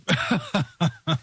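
short burst of chuckles; laughing. Real, not acted. Host amused by his own advice, laughing. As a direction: chuckle briskly.

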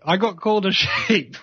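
laughing it off; real laugh at the insult. Real, not acted. Laughing at being called sheep.

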